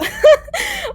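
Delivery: high squealing laugh; laughing at captain's sulking; high burst of laughter. Acted. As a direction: break into a high giggle. Sudden laugh in quarrel.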